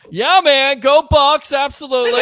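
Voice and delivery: loud, high-pitched team cheer; real fan excitement. Real, not acted. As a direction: shout it out like a fired-up fan. Host cheers back for the team.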